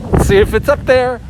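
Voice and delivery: high, loud suspense call; mock game-show host. Host checking the survey board, game-show style.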